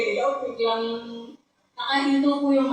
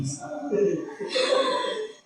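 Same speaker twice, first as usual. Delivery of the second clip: laugh; 5 semitones higher than usual. Real, not acted. Laughing mid-story about car stalling.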